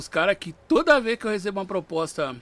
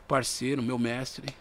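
usual speaking voice

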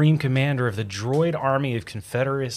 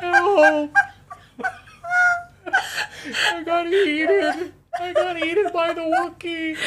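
high, whimpering muffled cry for help; squeaky fake-crying help; mock trapped victim. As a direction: play someone stuck inside a Wookiee. Mocking a trapped voice.

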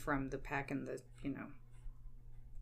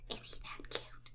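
hushed gossip; whispering; 12 dB quieter than usual. Acted. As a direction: whisper it excitedly.

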